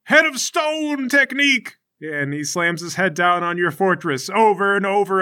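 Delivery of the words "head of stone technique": high, drawn-out move announcement; fighter's battle cry. Acted. Bald fighter attacking the fortress, battle cry. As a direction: call out a special attack heroically. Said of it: "he walks up and he goes".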